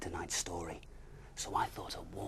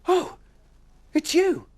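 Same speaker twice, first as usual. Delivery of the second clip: very high, loud relieved cry; surprised cry; 15 dB louder, slower than usual. Acted. Bear and owl recognising each other, very high loud cry. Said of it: "they both cried in unison".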